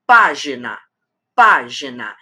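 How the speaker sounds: Página sounded out, pá strong and gina falling; stress on antepenultimate. Shown as a demonstration. Teacher counting three syllables, página stressed on pá. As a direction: lean on the first syllable of página. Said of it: "a antepenúltima é a forte".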